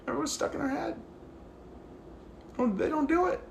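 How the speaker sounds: ordinary delivery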